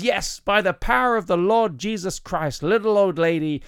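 slow, high American faith healer; drawn-out American preacher's proclamation; slight American accent. Acted. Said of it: "that's a slight American accent".

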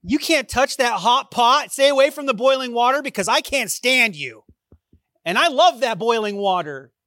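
a parent mockingly scolding the kids over the hot pot; mock scolding parent. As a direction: bark at the kids in jest. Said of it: "I don't say to my kids".

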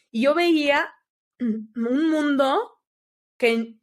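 slow, voice catching with real emotion; choked up. Real, not acted. Telling a friend about seeing the Great Wall, choked up. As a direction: let the words break as the memory hits.

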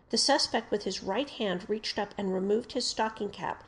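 ordinary delivery

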